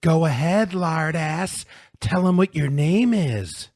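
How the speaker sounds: loud, high, gloating demand; sneering triumph. A narrator's reading. Bully astride his victim, sneering triumphantly. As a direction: crow over the beaten boy. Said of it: "sneering and triumphant".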